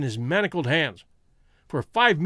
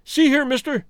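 sharply raised, challenging call; high-pitched, wary outburst; suspicious cry; 9 semitones higher, 4 dB louder than usual. A narrator's reading. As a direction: demand attention with sudden suspicion. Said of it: "he cried, with a gleam of suspicion".

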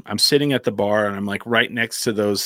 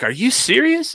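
amazed disbelief. Retold or imitated. Disbelief at the offered round.

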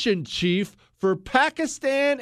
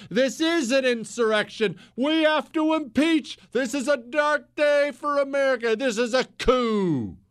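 mock alarmist parroting; 6 semitones higher than usual. Host mocking right-wing pundits parroting the left, high mock alarm. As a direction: mimic hysterical pundits sounding the alarm. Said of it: "begin to parrot the left's language".

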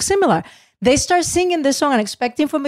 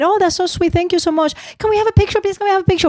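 gushing thanks; 8 semitones higher, faster than usual. Retold or imitated. She gushes back at fans.

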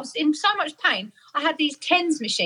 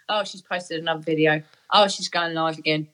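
mock boyfriend; 8 semitones lower than usual. Boyfriend mimicked reading her Facebook.

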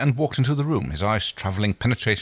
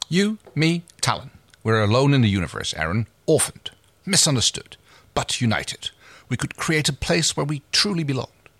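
Crace, pitched higher, proud and persuasive; lightly voiced scheming captain, raised pitch; 7 semitones higher than usual. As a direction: reader lifts her voice for Crace's pitch.

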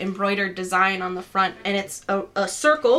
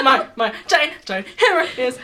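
stuttering fake sobs with laughter; half-laughing pretend blubber; mock sobbing; 4 semitones higher, 6 dB louder than usual. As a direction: stammer it like a hammed-up crier. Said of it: "Do you do it with the sobs?".

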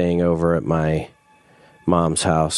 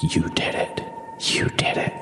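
whisper; 5 dB quieter than usual. Retold or imitated. Strangers greeting him about the fire.

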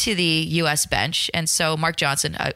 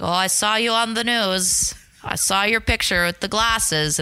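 slow, higher, lightly voiced old grandmother; a knowing grandma taking her time; grandma's voice; 7 semitones higher than usual.

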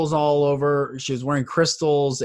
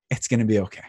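soft reassurance; 7 semitones lower, 5 dB quieter, faster than usual. Shown as a demonstration. Delivers the message softly.

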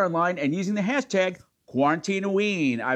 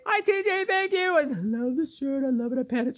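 cooing thanks; 9 semitones higher than usual. Real, not acted.

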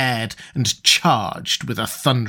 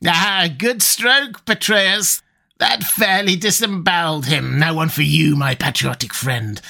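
higher, gleeful villain's voice, savouring the carnage; gloating sorcerer Nabonidus; 4 semitones higher than usual. Acted. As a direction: gloat over the slaughter like a fiend.

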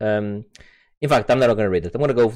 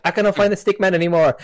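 lightly voiced, higher, quick camera; mock camera autofocus; 6 semitones higher, faster than usual. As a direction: give the confused camera a voice. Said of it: "the autofocus. It was like".

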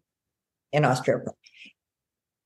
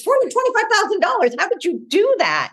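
amazed client. Retold or imitated. Client's amazed quote retold.

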